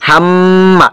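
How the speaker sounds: হাম্মা with the ম held as a hum; held ghunnah. Shown as a demonstration. Teacher showing students ওয়াজিব গুন্না, হাম্মা with a held ম. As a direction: hum on the ম of হাম্মা before releasing. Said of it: "ওয়াজিব গুন্নাটা এরকম কিছুক্ষণ সময় ধরে রাখতে হয়".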